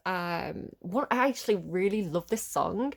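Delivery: normal talking voice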